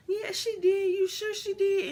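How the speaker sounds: imitating mom. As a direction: play mom covering up. Mom voiced reassuring.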